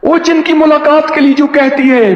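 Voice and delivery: ordinary delivery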